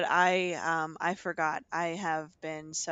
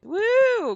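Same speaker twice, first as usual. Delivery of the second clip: loud, high woo; cheering whoop; 9 dB louder than usual. Real, not acted.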